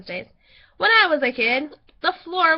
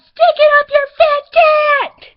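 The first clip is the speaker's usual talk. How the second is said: very loud, high, drawn-out yell; 11 semitones higher, 15 dB louder, slower than usual. Retold or imitated. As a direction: holler it slowly like an angry kid out the car window. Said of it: "so I yelled".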